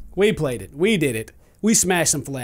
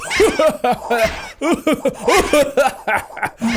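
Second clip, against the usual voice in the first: long, hammed-up villain laugh; evil Santa laugh; 5 semitones higher than usual. Gamer sure none will get through, evil Santa laugh. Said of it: "the evil Santa's laugh".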